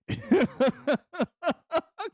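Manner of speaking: loud high cackle; high burst of laughter. Real, not acted. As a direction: laugh out loud at his own joke. Laughing at the bit.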